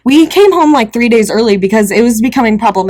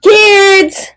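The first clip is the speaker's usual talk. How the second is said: yelling out the door; 11 semitones higher than usual. Acted. Parent yells out the door to the kids, yelling. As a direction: holler to round up the kids. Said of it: "Just yell out the door".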